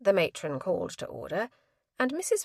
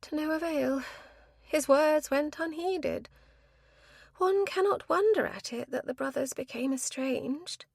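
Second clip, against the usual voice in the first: mournful; 9 semitones higher than usual. A narrator's reading. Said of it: "She sighed in a mournful fashion".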